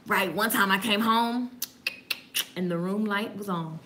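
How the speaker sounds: ordinary talk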